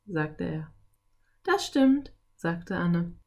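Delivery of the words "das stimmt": put-on high girl's voice; girl chiming in, pitched up; agreeing girl. A narrator's reading.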